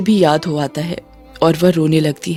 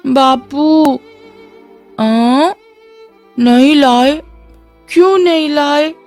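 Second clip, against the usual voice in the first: put-on deranged childlike babble; 6 semitones higher than usual. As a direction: call to father like a crazed child. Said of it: "Latika के पागलपन भरी बातें सुनाई देती".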